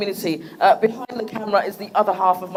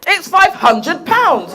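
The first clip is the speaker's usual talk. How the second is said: mock announcement; 8 semitones higher, 5 dB louder, slower than usual. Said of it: "No, it's not, I'm joking".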